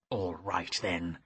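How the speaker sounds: low, grudging sorcerer grumble; grumbling. Acted. Defeated sorcerer conceding the horse, low grudging grumble. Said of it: "Stagmar grumbled".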